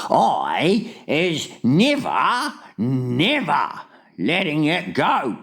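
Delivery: high, very slow vow; emphatic cry. A narrator's reading. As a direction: drag out each word with determination. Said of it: "the B F G cried".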